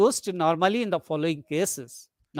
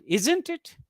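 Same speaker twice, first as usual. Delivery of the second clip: "isn't it" jumping up high; much higher, rising "isn't it"; question tag rise; 7 semitones higher than usual. Shown as a demonstration. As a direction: swing ISN'T IT sharply upward, seeking information. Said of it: "question text seeking information".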